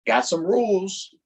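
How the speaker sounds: plain reading voice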